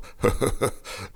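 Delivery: short breathy chuckle; a brief relieved laugh; old man's chuckle. Acted.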